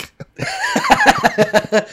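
a high, delighted peal of laughter; high burst of laughter. Real, not acted. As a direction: crack up at the joke. Laughing at own joke.

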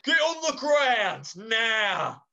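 loud, slow police order; police shout. Acted. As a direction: bark it like a cop. Police storm in.

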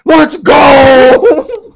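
high triumphant yell; victory shout. Acted. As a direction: hype up the crowd. Imagined celebration.

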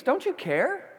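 a hint of hurt anger toward Jesus; mock indignant. Pastor imitating Martha accusing Jesus, mildly indignant. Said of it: "Anger towards Jesus".

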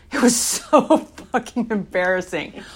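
real laugh bursting into the confession; loud, self-mocking laugh while admitting it; embarrassed laughter. Real, not acted.

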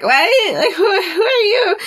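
high, stammered mock alarm; comic freaked-out questioning; startled [name].

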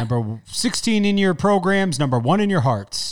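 ballpark PA call, high and slowed; PA announcer voice. Shown as a demonstration. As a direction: announce the batter to the stands. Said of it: "Public address announcer".

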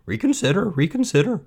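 high, insistent squawk; high repeated bird cry; goose crying out. Acted. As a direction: plead like an anxious goose. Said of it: "cried the goose".

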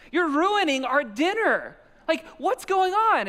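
high, loud, incredulous tirade; worked-up husband scolding over dinner; exasperated retort. Retold or imitated. Acted kitchen quarrel with wife.

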